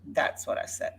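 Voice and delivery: soft, breathy murmured aside; muttered repeat. Real, not acted. Vlogger muttering again to herself after addressing Jody, quiet.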